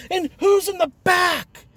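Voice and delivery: put-on high, loud panicked drunk questions; hammed-up frantic drunk, high and loud; freaking out. Drunk asking about passenger.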